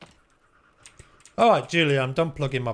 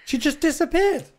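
surprised. Real, not acted. Friend vanishes in stealth armor.

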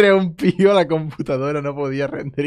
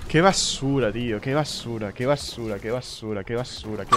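disgusted mutter; 4 semitones lower, 7 dB quieter than usual. Real, not acted. Muttering disgust after loss.

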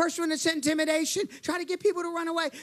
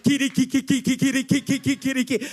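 rapid-fire repeated syllables; imitated tongues; 4 dB louder than usual. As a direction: rattle off staccato nonsense sounds like first attempts at tongues. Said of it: "I started speaking in other tongues".

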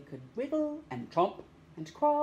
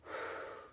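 a long audible breath out; breathy exhale; 10 dB quieter than usual. Shown as a demonstration. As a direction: blow the air out. Demonstrating breathing out.

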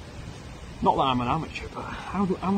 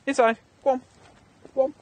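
dog command; 11 semitones higher, 5 dB louder, faster than usual. Real, not acted. Ordering dog inside.

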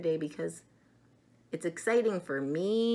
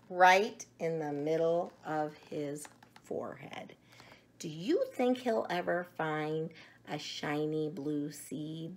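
put-on reading to children; 8 dB louder than usual. A narrator's reading. Reads picture book aloud.

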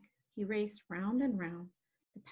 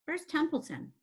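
higher-pitched, urgent question; demanding; 7 semitones higher, 3 dB louder than usual. Acted. Wilbur needing to act quickly, demanding. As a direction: insist on an answer at once. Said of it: "he demanded".

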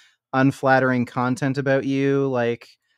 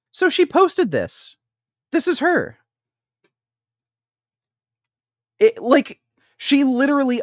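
incredulous; 13 semitones higher, 4 dB louder than usual. Real, not acted. Incredulous outburst at her post.

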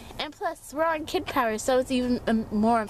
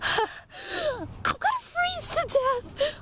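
dramatic despair; 13 semitones higher, much slower than usual. Acted.

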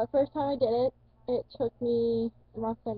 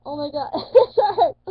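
loud, higher laugh through "it hurts"; laughing through pain; 4 semitones higher, 11 dB louder, slower than usual. Retold or imitated. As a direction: laugh out the pain, much louder. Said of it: "I was like".

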